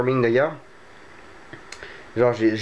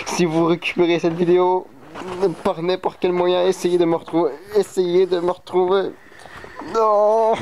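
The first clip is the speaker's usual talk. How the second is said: mock plea; 6 semitones higher than usual. Mock found-footage plea.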